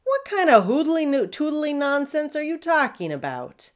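high, loud pompous bark; blustering captain. Acted. Pirate captain sputters at Curly, loud and high.

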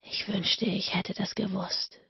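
soft whispered confession; soft whisper, a regret slipping out; sudden whisper. A narrator's reading. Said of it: "flüsterte er plötzlich".

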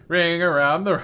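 ordinary talk

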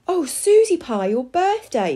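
high, dismayed realisation; shocked gasp. A narrator's reading. Mother remembers the forgotten birthday, mildly gasping. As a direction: gasp it, starting high and falling away. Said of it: "Missus Prune gasped".

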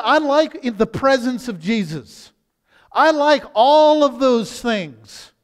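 loud, high preacher's voice, slowing on the last line; full-force preaching. Acted. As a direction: preach it out to the congregation.

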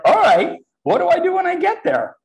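high, loud, startled agreement; retold eager reply. New hire answering the boss's order, high and loud. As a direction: act out saying yes while clueless. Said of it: "I was like".

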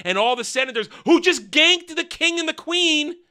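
ordinary delivery

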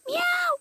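high-pitched meows like a cat; imitated cat meow. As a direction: meow like a cat to fool the border patrol. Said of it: "le haces como gato".

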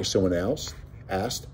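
usual speaking voice

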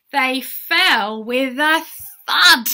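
loud storytelling with a big landing; dramatic thud. A narrator's reading. Narrator telling children how the herd fell, dramatic.

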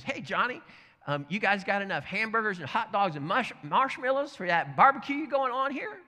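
high, sing-out friendly voice, rising to the end; mock-helpful, chipper and loud; cheerful neighbor. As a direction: needle them with innocent friendliness. Acted cheery taunt to cross burners.

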